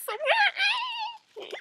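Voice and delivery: high-pitched, freaked-out squeal; squeamish squeal. Real, not acted. Grossed out at hearing birds in a nest, squeamish squeal. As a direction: voice shoots up into a squeal.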